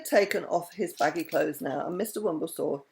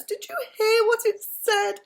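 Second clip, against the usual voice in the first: delighted; 5 dB louder, slower than usual. A narrator's reading. Princess delighted by the parrot.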